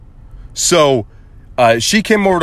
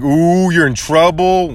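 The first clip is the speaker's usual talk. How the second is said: put-on slow, drawn-out childish taunt; mock-ominous singsong, pitched up; teasing scare; 4 semitones higher, slower than usual. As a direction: imitate a kid warning of trouble. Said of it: "You just wanted to scare 'em".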